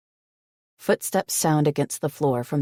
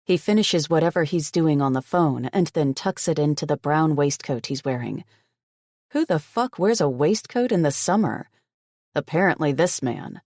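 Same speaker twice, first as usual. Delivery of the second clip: ordinary delivery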